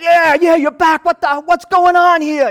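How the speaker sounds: high, loud high-five cheer; Jesus high-fiving everybody, pumped up; excited cheering. Pretend. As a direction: celebrate like rounding third base.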